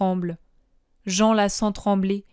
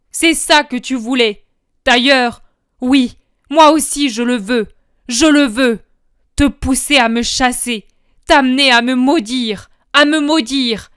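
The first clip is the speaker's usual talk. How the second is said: higher, louder furious shouting; furious yell; 4 semitones higher, 7 dB louder than usual. Acted. Judas screaming at Jesus, furious yell. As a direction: hurl the accusations at him. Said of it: "se jette presque contre Jésus en hurlant".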